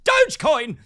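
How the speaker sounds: high, loud hyped-up bro; crypto bro. Retold or imitated. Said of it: "Crypto bros are like".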